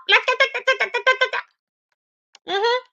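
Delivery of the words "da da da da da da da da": high da-da chatter for endless talk; mock blah-blah chatter; mock yapping. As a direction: babble like someone who won't shut up. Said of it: "just all mouth".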